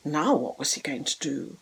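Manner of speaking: breathy whispered aside, low and soft; suspense whisper. A narrator's reading. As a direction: lean in and whisper the cliffhanger.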